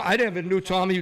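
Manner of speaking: normal talking voice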